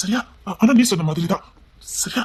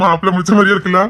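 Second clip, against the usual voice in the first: laughing question; 5 dB louder than usual. Real, not acted. Laughing about imagined baby.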